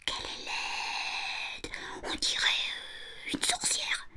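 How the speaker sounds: slow, soft, frightened whisper; horrified whisper. A narrator's reading. Little boy to himself on seeing the new housekeeper, horrified whisper. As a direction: whisper it slowly, appalled, to yourself. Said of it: "il fut épouvanté".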